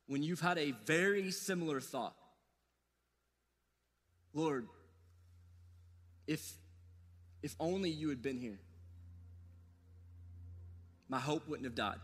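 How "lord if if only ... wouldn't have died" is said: quiet grieving prayer. Pretend. Voicing a heartbroken prayer.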